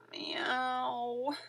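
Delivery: plaintive rising mew; sad meow. A narrator's reading. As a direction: mew in despair. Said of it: "cried Wilbur".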